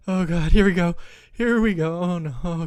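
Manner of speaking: loud, jittery self-hype; bracing nervously. Acted. Player about to start a hard fight, nervous. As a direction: brace yourself aloud, anxious and loud.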